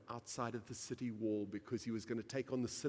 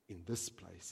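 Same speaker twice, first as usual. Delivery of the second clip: ordinary talk, nothing marked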